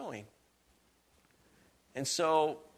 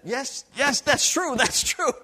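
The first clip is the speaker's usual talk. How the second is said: eager agreement; 9 semitones higher, 8 dB louder, slower than usual. Retold or imitated. Agreeing with the converted woman.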